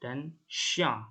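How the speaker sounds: "xiang" as sh-yan with a nasal ending; French chien. Shown as a demonstration. Teacher models "chien" for her students with a sh sound and a nasal end. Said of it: "cara bacanya sha".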